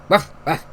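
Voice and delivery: short spoken "ruff, ruff"; imitated dog bark. Dog alerting Mom to someone at the door, imitated bark. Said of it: "and the dog barks".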